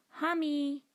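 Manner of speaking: put-on high little toddler saying it. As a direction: chirp it like a small child. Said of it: "he said".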